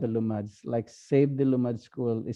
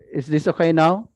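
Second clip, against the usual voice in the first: raised, higher voice testing the volume; louder, higher, rising question; speaking louder; 4 semitones higher, 7 dB louder than usual. Real, not acted. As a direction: speak up louder and check they can hear. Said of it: "can you speak louder?".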